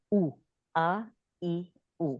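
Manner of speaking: "او، آ، ای، او" as separate oo, aa, ee, oo; isolated vowel sounds. Shown as a demonstration. Teacher drilling the three Arabic vowels, separate sounds "او، آ، ای، او". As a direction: sound out "او، آ، ای، او" one by one, lips rounded for oo. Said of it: "وہ گول ہونا ضروری ہے".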